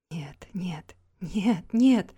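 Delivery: sobbing whisper rising to a cry; whispered no's breaking into a cry. Acted. Немоя в отчаянии над Гавейном. (отчаянный шёпот сквозь рыдания)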